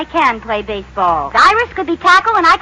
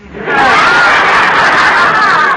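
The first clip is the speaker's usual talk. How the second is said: laugh; 4 dB louder than usual. Real, not acted. Laughs at her own Chanel joke.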